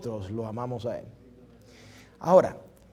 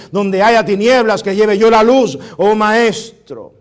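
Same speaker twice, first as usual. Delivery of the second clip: louder, declaiming each line like a prayer; fervent recited prayer; 2 semitones higher, 8 dB louder than usual. Acted. Pastor recites Saint Francis's prayer to the congregation, fervent and declaiming. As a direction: proclaim it as a prayer from the pulpit.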